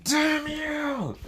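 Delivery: high-pitched, drawn-out villain yell; furious cursing shout. Acted. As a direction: scream the curse and hold it long. Aku curses his enemies in flashback.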